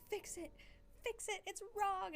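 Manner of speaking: high, hammed-up alarm; urgent alert voice. Red warning blinking at streamer, mock alarm. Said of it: "it's like blinking at me in red".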